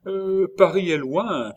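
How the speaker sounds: high, loud excuse. A narrator's reading. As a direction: object quickly on her behalf. Nurse defends the absent wife.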